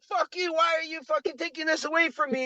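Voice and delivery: put-on high, whiny bratty kid snapping. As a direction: play the kid whose ice cream is grabbed. Kid voiced in story.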